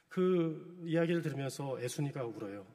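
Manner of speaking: ordinary talk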